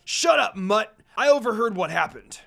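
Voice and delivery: loud furious shouting; angry shout. Acted. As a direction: scream at your rival. Heated argument between rivals.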